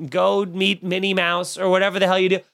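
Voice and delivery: normal talking voice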